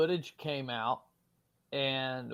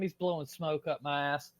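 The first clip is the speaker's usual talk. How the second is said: usual speaking voice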